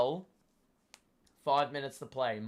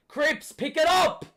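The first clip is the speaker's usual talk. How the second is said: excited shout; 12 semitones higher, 6 dB louder than usual. Real, not acted. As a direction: yell it high and loud. Fan yelling at player.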